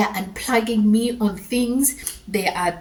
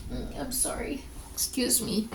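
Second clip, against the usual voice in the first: soft, higher, choked-up apology; getting emotional; 5 semitones higher, 9 dB quieter than usual. Real, not acted. Storyteller apologising to viewers as tears come, emotional. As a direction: excuse yourself quietly while holding back real tears. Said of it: "I'm sorry, I'm getting emotional".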